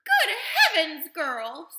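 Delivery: high, loud, slow screech; appalled mother screeching. A narrator's reading. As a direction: screech it in horror. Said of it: "screeched Missus Bro-, Beauregard".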